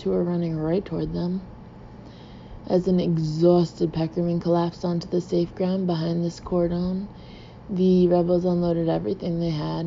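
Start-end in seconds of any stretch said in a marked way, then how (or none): none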